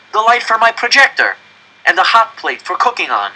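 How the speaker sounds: put-on high, eager child's voice selling the idea; eager pleading. Janek urging his parents to move into the coop, eager. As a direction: convince them, bursting with hope.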